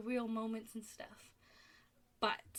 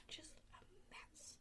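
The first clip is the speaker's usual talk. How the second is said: exasperated whisper; 8 semitones higher, 19 dB quieter, slower than usual. Real, not acted. Exasperated rant about the parents.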